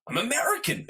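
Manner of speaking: high, put-on American voice; mock American. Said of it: "I'd be like".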